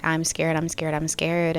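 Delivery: ordinary delivery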